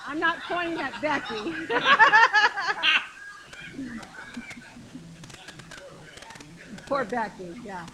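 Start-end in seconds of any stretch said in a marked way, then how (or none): 1.6-6.8 loud burst of laughter, trailing off; real laugh, high at first; hearty laugh. Real, not acted. Joking about not pointing at Becky, hearty laugh.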